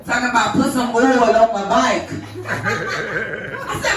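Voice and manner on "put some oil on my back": mock Florida accent, "back" turned into "bike"; husband's Florida accent. Wife quotes husband back in disbelief, imitated Florida accent. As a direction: mimic a husband's Florida way of talking. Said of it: "y'all accent ain't that bad".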